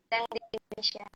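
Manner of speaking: ordinary talk, nothing marked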